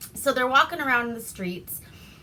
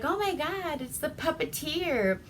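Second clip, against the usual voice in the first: put-on gushing girl; 7 semitones higher than usual. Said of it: "So Lucille's all like".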